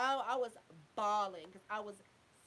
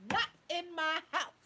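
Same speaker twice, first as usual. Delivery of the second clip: mock stern stepmom, louder and higher; stepmom's stern line; 5 semitones higher, 6 dB louder than usual. Stepmom laying down the law, stern and loud. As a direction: snap it like the strict stepmother. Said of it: "She said".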